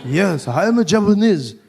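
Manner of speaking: put-on Japanese accent. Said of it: "He's like".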